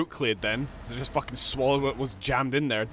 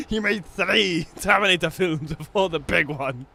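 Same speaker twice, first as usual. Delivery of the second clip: slow, higher put-on Arnold; 6 semitones higher, 5 dB louder, slower than usual. Gamer mimics Arnold about Terminator films, slow put-on voice. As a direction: say it as Arnold would. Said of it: "This fucking joke in his voice".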